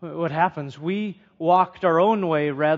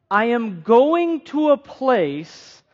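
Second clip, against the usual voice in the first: drawn out, "go" pushed up; the preacher stresses the repeated promise for listeners, slow and deliberate; slow emphatic quote; 4 semitones higher, slower than usual. Shown as a demonstration. Slow, lean on "place" and "go". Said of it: "He says it two times".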